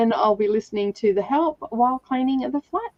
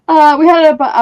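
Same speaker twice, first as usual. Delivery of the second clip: usual speaking voice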